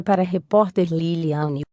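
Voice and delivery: normal talking voice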